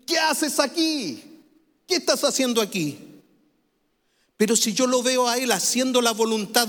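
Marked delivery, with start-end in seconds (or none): 0.1-3.0 stern scolding father. Acted. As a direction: demand it like a father catching his son in bad company. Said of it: "yo lo veo en mala junta, yo le voy a decir".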